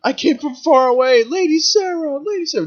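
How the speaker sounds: high, breathy devoted worshipper voice; mock adoring follower. Followers greeting Lady Sarah, high mock adoration. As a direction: gush at her like a devoted worshipper. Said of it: "everybody's kinda like".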